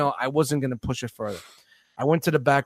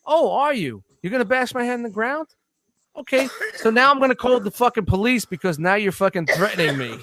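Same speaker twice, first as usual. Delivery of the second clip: sarcastic, much higher pitch; mocking sarcasm; 6 semitones higher than usual. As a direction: throw his threat back at him, mocking. Speaker mocking the customer's threat.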